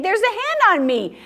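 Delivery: high-pitched pig in a picture book; complaining storybook pig. A narrator's reading. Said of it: "said the pig".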